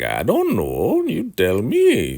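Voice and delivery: drawn-out, sing-song dodge of the riddle; coy tease. Retold or imitated. Elder Mother coyly tossing the riddle back at Obadiah, high and slow.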